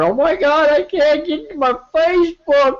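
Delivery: mock panic. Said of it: "everybody was all upset on Twitter".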